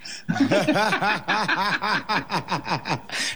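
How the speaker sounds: breathy high laughter. Real, not acted. Guest laughing at his robbery story, high.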